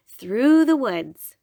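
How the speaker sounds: usual speaking voice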